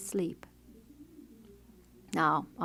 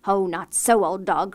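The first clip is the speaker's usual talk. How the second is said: scornful cry; 8 dB louder than usual. A narrator's reading. Stepmother scolding the dog, louder and scornful. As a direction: brush the dog off with contempt. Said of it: "cried the woman".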